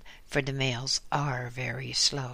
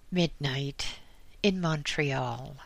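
ordinary delivery, nothing marked

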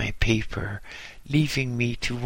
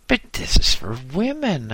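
higher, louder disgusted protest; 6 semitones higher, 5 dB louder than usual. Acted. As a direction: push it away in disgust. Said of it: "I said disgustedly".

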